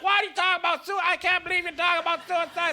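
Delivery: high-pitched scandalized panic; mock freaking out. People shocked at his talk about suicide, mock freak-out. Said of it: "People freak out".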